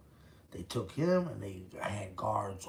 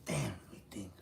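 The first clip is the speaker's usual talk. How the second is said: usual speaking voice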